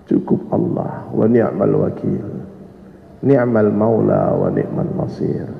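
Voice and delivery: slow, low and soft, unhurried; hushed, settled recitation of trust; serene trust. Retold or imitated. Ibrahim turns down Jibril's help, calmly assured.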